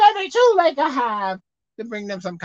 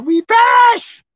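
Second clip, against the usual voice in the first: frightened cry; 9 semitones higher, 7 dB louder, slower than usual. Acted. Disciples cry to the Lord.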